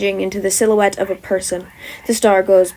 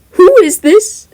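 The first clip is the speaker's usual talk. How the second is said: high, loud and sharp; loud demanding question; 10 semitones higher, 12 dB louder, slower than usual. Acted. Boy with glasses calling out to the stranger, loud and high. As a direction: call it out across the room.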